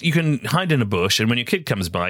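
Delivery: ordinary talk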